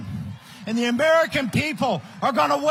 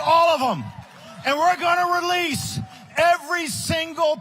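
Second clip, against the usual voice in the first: preaching at full force; 4 semitones higher than usual. Acted. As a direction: thunder it like a revival sermon. Preaching Trump inauguration vision.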